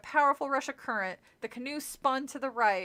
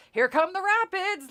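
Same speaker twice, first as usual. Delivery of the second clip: loud, high call over the rapids; shouted warning; 8 semitones higher, 8 dB louder than usual. Acted. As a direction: yell it across the water. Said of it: "Larry shouted".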